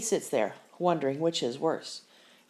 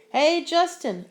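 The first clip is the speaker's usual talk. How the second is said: higher and louder, calling across the court; 10 semitones higher, 6 dB louder than usual. Acted. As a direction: call him from a distance. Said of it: "Emilio calls".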